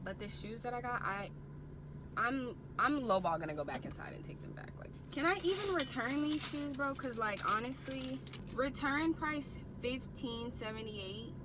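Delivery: hushed, talking under breath; whispering. Real, not acted. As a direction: murmur to herself in the car. Recorded quiet parking lot clip.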